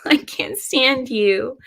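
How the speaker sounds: slow, drawn-out through laughter; half-laughing, pretend fed up; laughing mock exasperation. Streamer laughs at Samuel, slow pretend exasperation.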